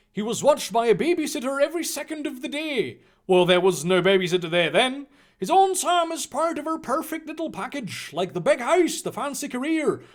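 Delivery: a hint of an indignant Hagrid; lightly voiced Hagrid. Hagrid justifying the abduction, lightly voiced.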